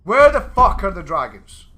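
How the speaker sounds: loud, high-pitched comic outrage; hammed-up tirade at full volume; requested mock rant. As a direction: demand it like an outraged ranter. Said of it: "Rant about the lack of dragons".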